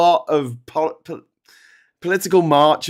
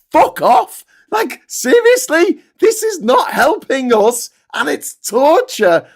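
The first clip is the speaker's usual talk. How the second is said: much higher and louder, fed up and blunt; loud exasperated retort; 12 semitones higher, 4 dB louder, slower than usual. Retold or imitated. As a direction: yell it back at the polite crowd. Rant against polite fellow marchers.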